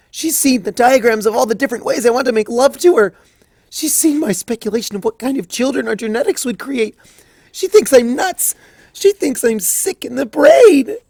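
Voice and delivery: high, loud, frantic pony rant; panicked Twilight. Acted. Twilight panicking that Celestia read her journal, high frantic rant. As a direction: spiral into pure mortified panic.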